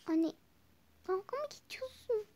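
a high, whining, near-crying protest; whiny complaint. Acted. Daughter whining at mother about being late, high whiny protest. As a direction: fake tears at mom.

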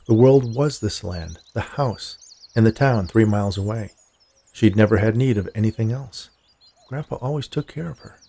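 plain reading voice

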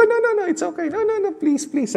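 high, pleading no no no; polite refusal. Retold or imitated. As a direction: insistently turn down the money, high-pitched. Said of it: "Sabi ko". (mataas na pakiusap na pagtanggi)

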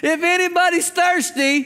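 high-pitched call to a whole crowd; crying out loudly. Retold or imitated. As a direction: proclaim it, voice lifted high. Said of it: "He cries out with a loud voice".

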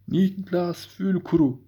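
slow, high drawn-out call; cave echo. Real, not acted. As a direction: draw the name out into the echo. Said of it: "odada ses yankı yapıyor".

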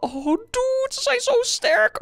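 very high panicked squeal; squeaky panic. Acted. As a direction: squeal in alarm. Panicked voice in fight.

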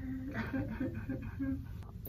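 quiet, breathy excited giggle; happy giggle. Real, not acted. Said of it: "I'm so happy".

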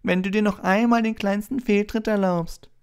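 screeching mock-mother scolding; shrill taunting imitation of a scolding mum; piercing shrill voice. A narrator's reading. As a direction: call out very high and loud. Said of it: "Mit durchdringend schriller Stimme rief er".

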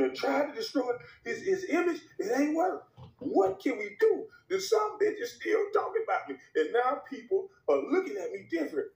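high-pitched whiny pleading; mock Stephen A. Skit voicing Stephen A pleading.

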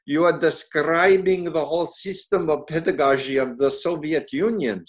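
hammed-up Russian accent. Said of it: "I'm gonna do a bad Russian accent now".

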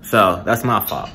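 ordinary talk

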